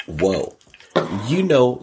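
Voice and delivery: ordinary delivery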